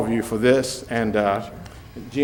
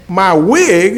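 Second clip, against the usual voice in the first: laughing; 6 semitones higher, 6 dB louder than usual. Retold or imitated. His own retold indignant reply.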